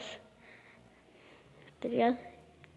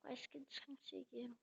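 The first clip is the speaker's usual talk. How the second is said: breathy hushed whisper; 19 dB quieter than usual. Real, not acted. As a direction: whisper so nobody hears. Whispering while hiding in game.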